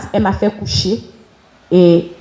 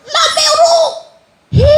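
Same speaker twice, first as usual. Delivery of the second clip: very high joyful shout, half laughing; shout with all her strength. Retold or imitated. The daughter shouting to her approaching mother, very high. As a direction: burst out shouting her name. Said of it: "l'envie de crier de toutes mes forces".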